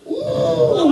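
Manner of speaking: high, far put-on ooh; a delighted little falsetto ooh; mock coo. Comic voicing the woman peering into it, high cooing ooh. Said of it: "she goes".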